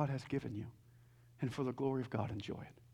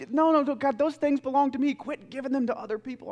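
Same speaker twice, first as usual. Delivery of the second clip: re-enacted frustration, high and loud at first; frustrated protest; 8 semitones higher, 7 dB louder than usual. Seminary student objects to God over others' blessings, frustrated. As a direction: object urgently, as if claiming what is yours. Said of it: "I used to get so frustrated".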